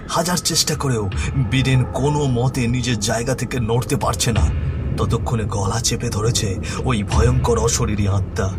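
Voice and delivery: usual speaking voice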